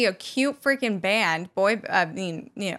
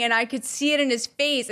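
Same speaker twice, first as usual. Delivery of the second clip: normal talking voice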